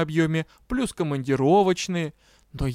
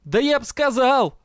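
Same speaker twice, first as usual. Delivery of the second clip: exasperated yell, pitched high; 8 semitones higher, 4 dB louder than usual. A narrator's reading. Дроу answers irritated colleague, yelling in exasperation. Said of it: "заорал Дроу".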